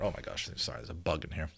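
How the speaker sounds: hushed, breathless aside; startled aside. Real, not acted. Distracted by a bug while reading, hushed startled aside. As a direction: drop to a near-breath, caught off guard.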